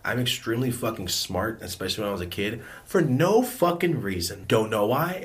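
ordinary delivery

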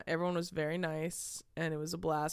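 ordinary delivery

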